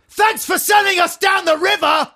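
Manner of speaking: very high, loud yell; mock outraged shout. Co-host raging at a politician in spoof phone-in, mock outraged shout. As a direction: explode in put-on fury at the politician.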